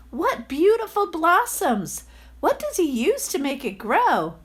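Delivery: high, wondering villager voice; admiring villager. Acted. A villager marveling at the landlord's tree, high and admiring. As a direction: gush over the blossoms.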